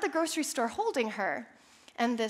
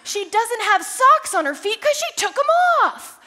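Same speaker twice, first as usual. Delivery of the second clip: loud, high retort; yelling back; 8 semitones higher, 7 dB louder than usual. Retold or imitated. Mom snapping back at the old woman, yelling high and loud. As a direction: fire it back in a rage. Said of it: "I yell back at her".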